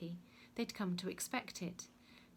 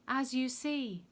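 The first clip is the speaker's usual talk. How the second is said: raised, higher voice to a crowd; calling out; 5 semitones higher, 7 dB louder, slower than usual. A narrator's reading. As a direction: call it out loud from the platform. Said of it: "Pilate called out".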